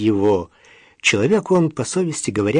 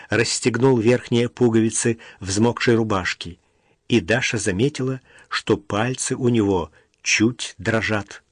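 ordinary delivery

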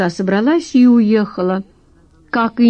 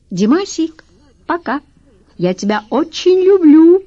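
high, sugary goodbye; cartoon princess; 9 semitones higher, 4 dB louder than usual. A narrator's reading. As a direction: coo it like a princess. Girlfriend blowing a kiss goodbye.

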